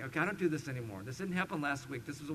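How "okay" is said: ordinary talk, nothing marked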